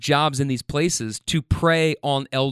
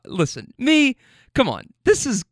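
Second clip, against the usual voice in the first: ordinary talk, nothing marked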